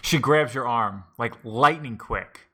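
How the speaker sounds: ordinary talk, nothing marked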